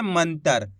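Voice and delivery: मंतर said in one quick breath, nasal bindi run straight in; blended whole word. Shown as a demonstration. Teacher showing how मंतर is read fluently. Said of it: "इस बिंदी का उच्चारण तेजी के साथ निकालना है".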